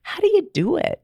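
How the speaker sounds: high, eager imitation of her past self asking a happy friend; eager question. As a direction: beg her for the secret, high and bright. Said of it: "And I'm like".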